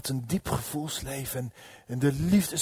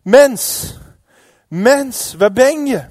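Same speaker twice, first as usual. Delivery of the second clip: loud, raised summons; calling out; 9 semitones higher, 15 dB louder, much slower than usual. Acted. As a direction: call out as God seeking man. God roept de mens. (luid roepend als God)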